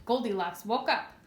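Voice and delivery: ordinary delivery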